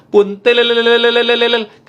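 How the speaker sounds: high, loud, rippling trilled street call; buntil vendor's echoing cry. Retold or imitated. Buntil seller calling through the neighbourhood, echoing high cry. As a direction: roll the last syllable out like an echo. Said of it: "Kayak ada ehonya".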